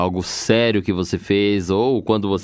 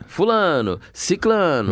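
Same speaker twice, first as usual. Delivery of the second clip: ceremony announcer; 9 semitones higher, much slower than usual. Retold or imitated. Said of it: "o mestre de cerimônia vai lá no caso e anuncia".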